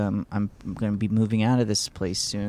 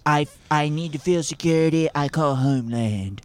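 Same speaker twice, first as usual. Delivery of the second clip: Donald Trump impression; 3 semitones higher than usual. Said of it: "impression of your dad".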